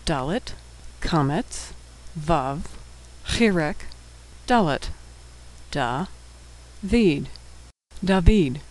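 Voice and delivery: letter by letter, then syllable by syllable; sounding out. Shown as a demonstration. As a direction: spell it out with pauses, then say the whole word. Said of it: "we'll be sounding out all of the words".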